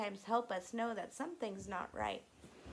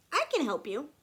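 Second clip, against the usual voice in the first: higher, louder anger voice; anger's voice; 6 semitones higher, 9 dB louder, faster than usual. Acted. Teacher voicing Anne's anger, higher and louder. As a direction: give the anger a bold voice.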